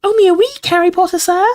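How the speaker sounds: very high, squeaky elf voice; happy house elf. A narrator's reading. Dobby answers Harry happily, high elf squeak. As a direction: pipe it up like a cheerful little elf. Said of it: "said Dobby happily".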